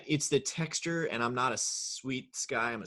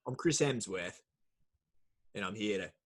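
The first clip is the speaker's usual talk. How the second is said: mock sleep meditation; 3 semitones lower, 4 dB quieter than usual. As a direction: lull the listener like a bad sleep app. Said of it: "You would have the worst Calm app ever".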